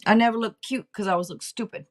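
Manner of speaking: normal talking voice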